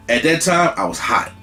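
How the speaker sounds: ordinary talk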